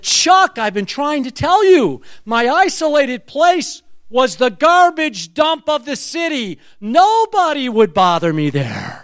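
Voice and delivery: high-pitched, insistent retold outburst; imitating an old man's emphatic explanation; exasperated heckler. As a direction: call it out as if finally getting through. Acts out the yelling elderly man.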